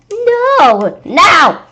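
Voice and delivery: high, loud refusal; defiant yell. Acted. Miss Yonkers refusing the monster, loud high yell. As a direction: snap back with a yell. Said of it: "Miss Yonker yelled".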